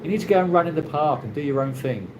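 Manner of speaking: normal talking voice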